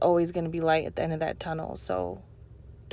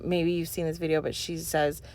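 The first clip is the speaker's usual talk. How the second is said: ordinary talk, nothing marked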